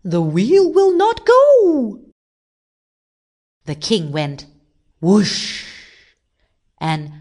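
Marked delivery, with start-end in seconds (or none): 0.0-2.0 slow, high, loud cry; alarmed shout. A narrator's reading. People shout that the wheel is stuck, alarmed. 5.0-5.7 loud whoosh with long hushing tail; imitated whoosh. A narrator's reading. King swinging on the wheel, imitated whoosh.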